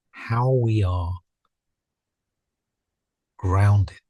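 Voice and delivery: unhurried meditation guidance with long pauses; spacious, measured meditation voice, leaving silence between phrases; guided meditation. Real, not acted. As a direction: settle the listeners gently into stillness, phrase by phrase.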